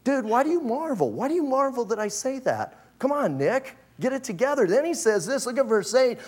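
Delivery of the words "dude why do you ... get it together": raised-pitch exasperated Jesus, higher voice; Jesus voiced. Jesus chiding Nicodemus for marvelling, higher exasperated voice. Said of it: "And he goes".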